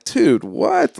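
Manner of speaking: high-pitched, baffled reaction; retold disbelief. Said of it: "and I'm like".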